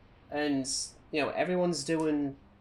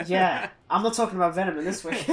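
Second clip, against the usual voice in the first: louder, higher, laughing through it; laughing refusal; 6 semitones higher, 8 dB louder than usual. Real, not acted. Podcast host refusing Venom talk, laughing loudly. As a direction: laugh it off, half-joking.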